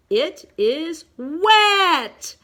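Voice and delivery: very slow, building to loud WET; loud exclamation. Shown as a demonstration. Teacher shows what an exclamation point does, loudly. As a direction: stretch it and burst out on WET. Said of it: "exclamation point makes it louder".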